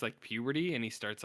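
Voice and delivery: ordinary delivery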